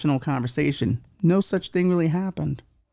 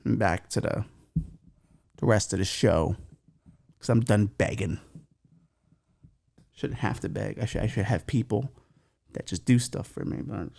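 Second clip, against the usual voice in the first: low, soft sulky grumble; sulky muttering; 6 semitones lower, 6 dB quieter than usual. Acted. Host done begging listeners, sulking quietly. Sulks after finishing his begging.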